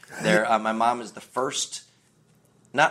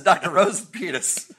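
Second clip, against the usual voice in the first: high, louder punchline; Fletch line; 10 semitones higher, 4 dB louder than usual. Retold or imitated. Quoting Fletch's doctor gag, high and louder. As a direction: deliver the Fletch gag bright and loud. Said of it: "he says".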